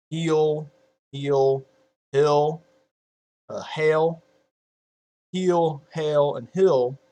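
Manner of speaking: "Heel", "hill", "hail" as separate drawn-out words; Southern vowel demo. Shown as a demonstration. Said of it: "are the same pronunciation for them".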